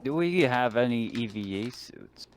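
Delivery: ordinary talk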